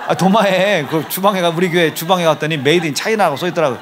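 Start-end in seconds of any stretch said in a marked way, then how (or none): 0.1-0.9 laughing. Real, not acted. Laughs at punchline.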